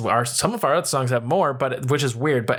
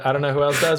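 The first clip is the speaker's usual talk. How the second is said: ordinary delivery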